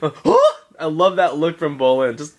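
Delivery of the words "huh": high huh through a laugh; high laughing repeat of huh. Retold or imitated. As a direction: giggle while imitating him. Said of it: "He had that".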